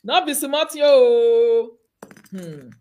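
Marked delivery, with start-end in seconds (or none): 0.0-1.7 loud, very slow teasing sing-song; hammed-up slow taunt; drawn-out mock call. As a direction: drag every word out to tease.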